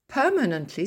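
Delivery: Permanently with the stress still on PER. Shown as a demonstration. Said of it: "ça n'a pas changé". (accent tonique toujours sur PER dans Permanently)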